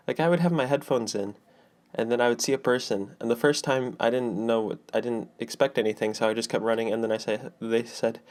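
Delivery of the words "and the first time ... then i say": ordinary talk, nothing marked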